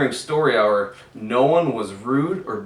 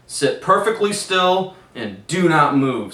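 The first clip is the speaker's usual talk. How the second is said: stern teacher; 5 semitones higher, 6 dB louder than usual. A narrator's reading. Miss Swamp barking orders.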